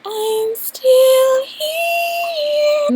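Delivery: very high, drawn-out eerie imitation, far off; creepy voice. Retelling her tripping self on the recording, creepy high voice. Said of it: "in a really creepy, creepy voice".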